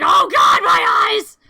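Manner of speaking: very loud anguished scream; pained shout. Acted. Creature blinded by the camera flash, screaming in pain. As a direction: yell as if blinded, all out.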